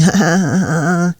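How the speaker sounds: high, drawn-out chuckle as the gym bro; imitated high cackle after a boast; bragging bro laugh.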